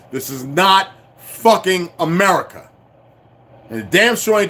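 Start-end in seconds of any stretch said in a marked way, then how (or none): 0.1-2.7 "not fucking America" slow and punched; emphatic repetition. Real, not acted. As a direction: spell out "not fucking America" word by word, falling at the end. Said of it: "I'm gonna say it again".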